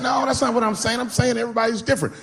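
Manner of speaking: high-pitched, hurried backpedaling; defensive protest. Retold or imitated. Backpedals when friend takes offence.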